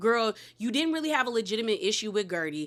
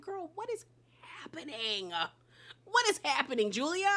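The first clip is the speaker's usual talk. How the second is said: disbelief; 6 semitones higher, 5 dB quieter than usual. Real, not acted. Host reacts in disbelief.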